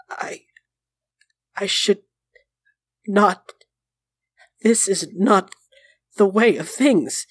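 broken phrases with long pauses; halting refusal. A narrator's reading. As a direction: struggle to get each word out. Wounded Sarabas hesitates, refusing help.

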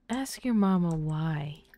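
normal talking voice

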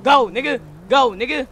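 ordinary talk

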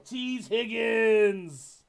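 pull-reveal hype call; drawn-out hype. Acted. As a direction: stretch the name out big. Hyped reveal of card name.

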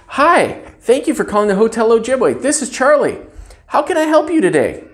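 high, bright, eager greeting; delighted to take the call; cheerful phone greeting. Shown as a demonstration. Clerk answering a call while a guest waits, cheerful.

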